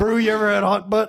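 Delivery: mock bro patter; Randolph. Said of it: "And Randolph's like".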